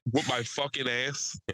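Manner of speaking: usual speaking voice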